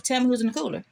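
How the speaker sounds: pitched well up, pressing for an answer; demanding character voice. Retold or imitated. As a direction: push hard, like you've asked three times already. Acted quarrel between two characters.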